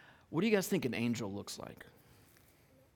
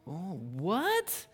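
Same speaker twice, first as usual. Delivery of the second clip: mock surprise; 2 semitones higher than usual. Teacher teasing kids with a twist in the story, mock surprise. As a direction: play at being baffled for the kids. Feigns shock that it's not Mary, Joseph.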